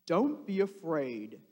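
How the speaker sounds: a hint of calm comfort; calm reassurance. Jesus calming the scared disciples, understated. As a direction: soothe them gently. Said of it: "said Jesus calmly".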